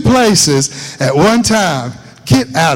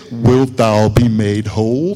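low, slow solemn recitation; mock-pious deep scripture reading; religious King James voice; 7 semitones lower, slower than usual. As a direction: intone it like old scripture, low and drawn out. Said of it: "That sounds so religious".

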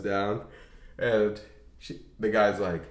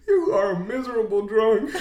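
high, sobbing laugh of a voice; mock tearful self-reproach; 10 semitones higher than usual. Actor alone scolds himself for drinking, hammed-up tearful voice. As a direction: imitate weepy self-loathing, half laughing.

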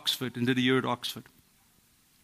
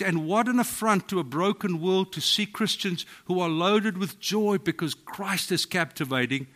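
usual speaking voice

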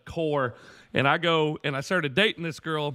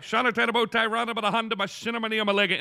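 higher, run-together recitation; practicing the saying; 4 semitones higher, faster than usual. Retold or imitated. Boyfriend rehearsing fake tongues, hurried recitation. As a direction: rattle it off like reading from a note. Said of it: "So she wrote it down".

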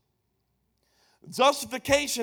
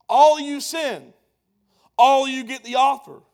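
loud preaching retort; 4 semitones higher, 10 dB louder than usual. Acted. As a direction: shout back the answer. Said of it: "answering objection".

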